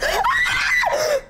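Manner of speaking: sharp frightened gasp. Real, not acted. Jump scare in horror game.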